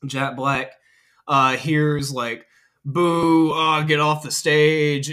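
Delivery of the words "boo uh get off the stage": louder, slow jeering boo; a retold crowd booing, half-shouted; mock heckle. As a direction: heckle him off the stage, hammed-up. Imagined heckling crowd at show.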